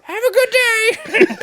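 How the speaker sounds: high, slow mock sweetness; sugary farewell like a spiteful old woman; mock old lady. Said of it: "Arlene quote".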